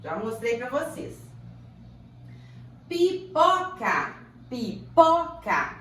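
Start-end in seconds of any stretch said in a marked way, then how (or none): 2.9-4.1 "Pipoca" slow and high, split pi-po-ca; "Pipoca" drawn out for a class, louder and higher; syllable by syllable. Shown as a demonstration. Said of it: "A sílaba tônica é o pó". 4.5-5.8 "pipoca" slow and loud, punching the middle PO; "pipoca" split up, higher, with a strong middle syllable; stress on PO. Shown as a demonstration. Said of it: "A sílaba tônica é o pó".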